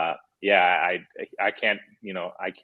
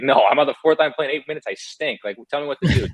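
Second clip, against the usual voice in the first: higher, louder acted complaint; a player on the fourth line fed up with excuses, raised voice; mock frustrated player; 5 semitones higher, 5 dB louder than usual. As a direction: vent like a benched player demanding answers. Player venting at sugarcoating coach.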